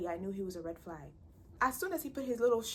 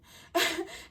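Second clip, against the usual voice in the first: short laugh; 8 semitones higher, 5 dB louder than usual. Real, not acted. Laughing at own retort.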